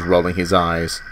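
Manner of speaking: plain reading voice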